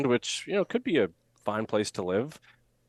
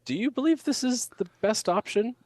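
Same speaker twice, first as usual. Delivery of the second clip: much higher, incredulous mock questioning; doubtful listener; 13 semitones higher than usual. Coach mocking a listener who doubts the engineer's pitch. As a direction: poke holes in the claim, openly doubting. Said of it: "it's like".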